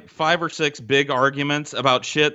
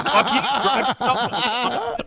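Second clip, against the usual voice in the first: slow, higher, laughing brush-off; dismissive retort; 8 semitones higher, much slower than usual. Retold or imitated. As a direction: laugh him off and walk away. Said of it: "And I was like".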